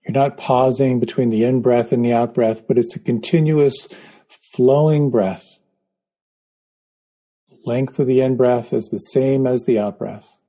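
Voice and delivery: guided meditation. Acted. Leads a guided breathing meditation.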